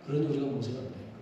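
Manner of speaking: 한 번 더, 작고 빠르게 혼잣말처럼. Shown as a demonstration. (조용하고 빠르게 되뇌기)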